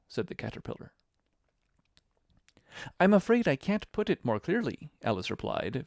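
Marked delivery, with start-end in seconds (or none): 3.0-4.9 high-pitched, polite apology. A narrator's reading. Alice answering the caterpillar, very polite. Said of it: "very politely".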